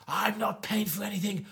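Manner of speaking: hushed urgent whisper. Retold or imitated. Telling John off quietly, urgent whisper. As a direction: hiss it under breath.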